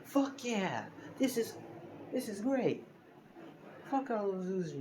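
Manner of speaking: high-pitched acted cheering; excited. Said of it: "but, you know, excited".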